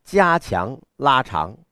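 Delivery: 加强拉长 said slowly, each word drawn out with extra weight; stressed and lengthened. Shown as a demonstration. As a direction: lean on 加强 and stretch 拉长. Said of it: "可以加强拉长". (加强、拉长，重读每个词)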